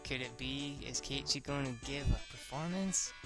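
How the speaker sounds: ordinary delivery, nothing marked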